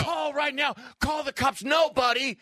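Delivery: high-pitched screamed alarm; screaming. Acted. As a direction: scream for help at an intruder. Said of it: "I will start screaming".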